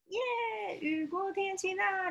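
very high joyful cheer; excited cheer. A narrator's reading. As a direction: sing out the sunshine. Cheering sunshine.

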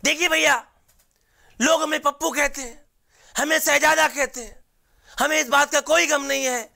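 high, whiny politician impression; Rahul Gandhi voice. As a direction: pitch it up and plead like a grown child. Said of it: "तो उनका एक अंदाज है कि".

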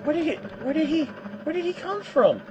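high, fast, rattled questions; startled high babble. Real, not acted. Gamer is startled by a soldier appearing, high and fast. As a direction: blurt it out, caught off guard.

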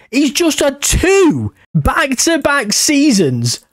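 high, slow, stunned disbelief; gobsmacked. Real, not acted. Gamer to viewers, stunned by back-to-back records, high slow disbelief. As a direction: drag it out in astonishment. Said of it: "I am absolutely gobsmacked".

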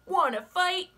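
high, loud, grumpy bug; grouchy ladybug voice. Acted. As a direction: bark the challenge up high. Ladybug challenges elephant.

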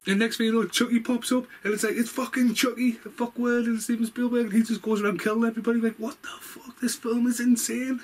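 high-pitched giddy disbelief; excited rush. Real, not acted. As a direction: let the excitement run away with you. Excited retelling of Chucky scene.

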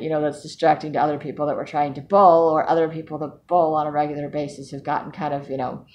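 ordinary delivery

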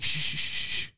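sharp unvoiced shushing; detective shush. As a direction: hush everyone urgently. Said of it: "He's like".

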